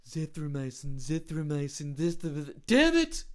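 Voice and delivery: a put-on voice drilling the word, blowing up at the end; mock Trump practicing. Comedian imitating the president practicing in a mirror, ending in a furious shout. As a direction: rehearse the word in the mirror, then snap. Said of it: "way too happy with himself for pronouncing zithromycin".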